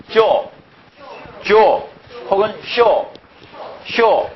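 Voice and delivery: teacher drilling Japanese readings aloud. Shown as a demonstration. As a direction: model the Japanese 쇼 as a classroom drill. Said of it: "일본어로는 발음이 어찌 됩니까".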